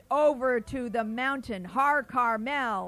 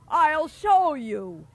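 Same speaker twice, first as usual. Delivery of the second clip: boastful giant; 3 semitones higher, 7 dB louder, slower than usual. Acted.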